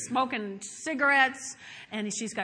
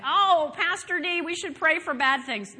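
raised, louder silly imitation; mock foolish follower; 4 semitones higher, 4 dB louder than usual. Churchgoer misunderstanding the pastor, mock foolish voice. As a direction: put on a dim, eager churchgoer. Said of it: "don't get stupid on me and say".

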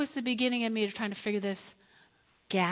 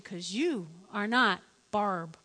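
slow, spaced-out "you are not Barb"; deliberate, low-key put-down; measured refusal; 4 semitones lower, 3 dB quieter, slower than usual. Retold or imitated. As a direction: let "Barb" fall, slowly and softly.

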